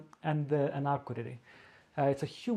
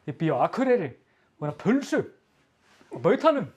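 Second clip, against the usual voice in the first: northern Icelandic accent; 2 semitones higher, 4 dB louder, faster than usual. Shown as a demonstration. Local shows off the northern Icelandic accent with harsh consonants. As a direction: play an Akureyri local, every consonant hard in northern Icelandic style. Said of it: "K with a very harsh way".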